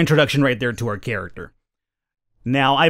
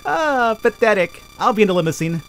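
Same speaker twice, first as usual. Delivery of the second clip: sneering; 6 semitones higher than usual. Pretend. Snobby rival sneers.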